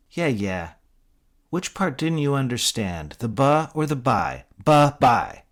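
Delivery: high, loud mocking goodbye; sarcastic. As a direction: brush them off with sass. Sarcastic dismissal.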